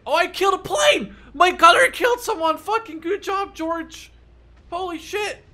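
very high, loud victory cheer; cheering his gunner George on; triumphant shout. Real, not acted. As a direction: yell it out in real triumph. Gunner kills a plane.

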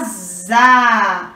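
ZA with a long, held A; teacher sounding out a long "za"; syllable sound demo. Shown as a demonstration. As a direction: buzz the Z, then open into a drawn-out "za". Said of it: "a sílaba".

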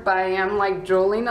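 muffled behind fingers; speaking through hand; hand over mouth. Real, not acted. Seen 1: Fingers cover mouth while talking about drooling; lowered by 1.4s.

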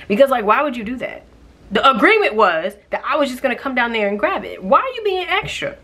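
high, loud, annoyed rant; exasperated rant. Real, not acted. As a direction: let the irritation boil over. Ranting about the shopper.

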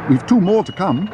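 high-pitched call to a driver. A narrator's reading. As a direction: call out to hold him back. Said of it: "called Missus Brown".

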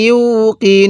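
ইউকিনুন with a long held final "nuun"; measured model reading of ইউকিনুন; slow tajweed recitation. Shown as a demonstration. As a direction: draw out the ending of ইউকিনুন. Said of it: "কিভাবে পড়তে হবে?".

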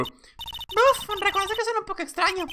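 high put-on voice for a character; a lightly voiced witness. Voicing the witness, a high character voice.

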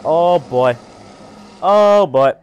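building alarm at a boss. Real, not acted. Boss appears, rising alarm.

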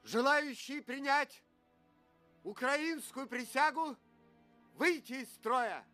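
commanding. Acted. Officer addressing the ranks.

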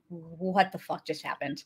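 hushed, dropped-low disbelief; stunned. Retold or imitated. Retelling everyone's reaction after the trance, stunned and hushed. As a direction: let it out softly, as if dazed. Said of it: "everyone's just kind of stunned".

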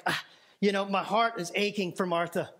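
ordinary delivery

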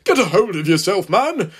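loud, stern station master's rebuke; angry snap. A narrator's reading. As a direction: snap at a hysterical underling. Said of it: "snapped the man in charge".

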